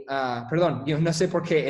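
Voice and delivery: ordinary delivery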